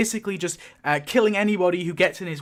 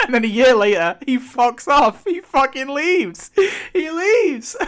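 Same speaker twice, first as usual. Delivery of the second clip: laughing in disbelief, pitch climbing high; cracking up while ranting, voice breaking into laughter; 6 semitones higher, 5 dB louder, slower than usual. Real, not acted. Incredulous laughing rant about Daryl.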